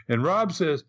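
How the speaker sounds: ordinary delivery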